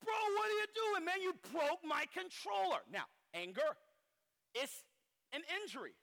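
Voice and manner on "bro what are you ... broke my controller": angry friend. Retold or imitated. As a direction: yell at your buddy over the broken controller. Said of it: "the friend gets angry".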